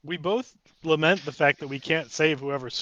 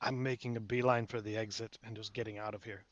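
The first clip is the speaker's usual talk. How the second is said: low, hushed voice; whispered line; 4 semitones lower, 10 dB quieter than usual. Acted. Invisible player tipping off a companion, hushed whisper. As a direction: say it under your breath, much softer. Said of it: "I'm just going to, to whisper".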